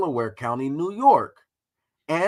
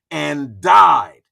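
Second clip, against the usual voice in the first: shouted died; 10 dB louder than usual. Shown as a demonstration. Streamer mocking Du Bois's end, shouting died. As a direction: blast the one word like a punchline. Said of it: "We gotta put the emphasis on that shit".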